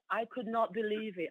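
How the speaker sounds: usual speaking voice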